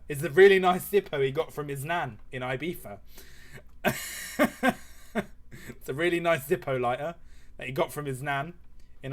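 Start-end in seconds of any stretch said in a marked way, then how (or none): 3.0-5.6 high, breathless laughing fit; helpless wheezing laugh. Real, not acted. Streamer at the nan's Ibiza lighter, helpless laughter. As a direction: lose it at your own silly detail. Cracks up at own lighter detail.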